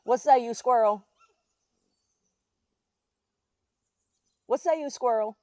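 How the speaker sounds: loud, high holler to a squirrel; high-pitched, ringing call. Pretend. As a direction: playfully shout up at an animal. Calls out to a squirrel.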